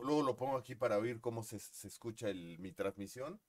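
usual speaking voice

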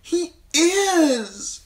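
put-on high, loud girlish insistence; insisting girl. A narrator's reading. Daughter contradicting her father, high and insistent. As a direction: dig in like a stubborn child. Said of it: "Sansa insisted".

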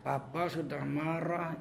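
slow, quiet anger through clenched teeth; drawn-out soft mock warning with teeth shut; retold clenched-teeth anger. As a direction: imitate a dad seething softly, teeth clamped tight. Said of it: "Digigitnya, giginya itu rapat".

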